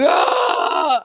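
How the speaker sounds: sharp high startled yelp; high sudden surprised yelp; startled cry. Real, not acted. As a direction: gasp in sudden shock. Sudden in-game shock.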